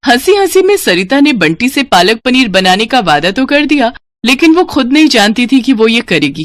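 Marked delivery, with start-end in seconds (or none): none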